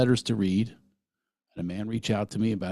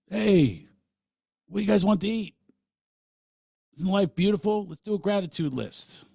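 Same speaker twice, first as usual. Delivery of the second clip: much higher, sing-song upbeat family chatter; cheery new dad voice; 11 semitones higher than usual. Retold or imitated. Father to his kids at home, much higher and chirpy. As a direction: sell the sunny new mood a bit over the top. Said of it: "Now the talks are".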